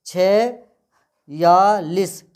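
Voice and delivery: word broken up with long pauses; very slow, each syllable set apart. Shown as a demonstration. Teacher spelling the word for students, slow and separated. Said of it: "ठीक है, छियालीस".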